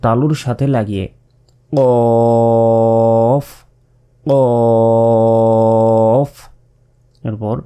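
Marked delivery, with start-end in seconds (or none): 1.7-6.3 গলার ভিতর থেকে কফ; Arabic qaf from the throat. Shown as a demonstration. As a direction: push কফ up from the back of the throat. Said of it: "জিহ্বার গোড়া তার বরাবর উপরের".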